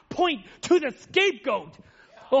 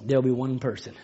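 much softer, lower preaching over music; soft altar call; 12 semitones lower, 9 dB quieter than usual. Acted. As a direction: ease the room into quiet prayer.

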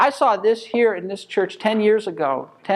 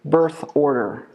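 BIRTH ORDER spaced out slowly and deliberately; slow, deliberate repeat; slower than usual. Real, not acted. Repeating it for a questioning listener.

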